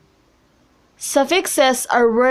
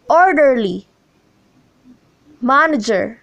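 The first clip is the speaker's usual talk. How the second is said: ORderly, MANager, opening syllable stressed; first-syllable stress. Shown as a demonstration. Teacher reads examples Orderly, manager, each stressed on the first syllable. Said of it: "the stress is placed on the first syllable".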